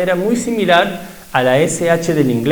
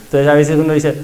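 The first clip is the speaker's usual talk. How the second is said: ordinary talk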